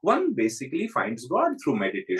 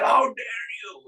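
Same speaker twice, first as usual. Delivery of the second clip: higher, slow indignant cry; offended outburst; 9 semitones higher than usual. Retold or imitated. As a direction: hurl it back as an insult to your pride. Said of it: "first he got very upset".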